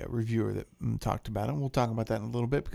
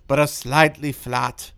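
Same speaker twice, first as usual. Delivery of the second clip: loud, slow, hammed-up French accent; bad French accent; 7 dB louder than usual. Host reads the wine review in a deliberately bad French accent. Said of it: "I will provide a bad French accent".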